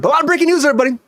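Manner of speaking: high, bright hype; announcer hype. Acted. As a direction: pitch it up like a show host. Host announcing breaking news again.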